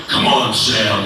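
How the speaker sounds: mock deep voice. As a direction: drop it low to outdo the new guy. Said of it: "So then he was like".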